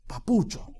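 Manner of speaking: soft, loving "Papucho"; affectionate papucho. Shown as a demonstration. Preacher teaching a tender name for God, softly. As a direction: call him "Papucho" fondly. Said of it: "Con cariño".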